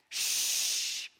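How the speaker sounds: long unvoiced "shh"; sustained shushing hiss; hushing shh. Jesus hushing the winds and waves, long shush. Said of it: "He literally hushed the winds".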